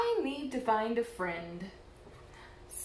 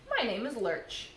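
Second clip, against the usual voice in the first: ordinary delivery, nothing marked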